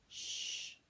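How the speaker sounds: soft unvoiced hush; whispered shush. Baby hushing so sleeping Daddy is not woken. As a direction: breathe the shush out softly.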